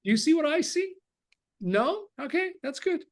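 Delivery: high, light carefree asking; playful eagerness. Retold or imitated. Asking a listener if they see it, high playful eagerness. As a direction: shrug off the no cheerfully. Speaker voicing his own carefree sharing.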